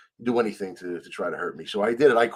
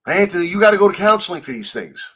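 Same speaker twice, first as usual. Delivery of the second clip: mimicking Lenny; 7 semitones higher, 8 dB louder than usual. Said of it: "I talk to Lenny".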